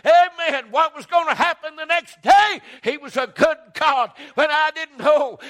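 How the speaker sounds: full-force preaching. Acted. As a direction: preach it out to the whole church.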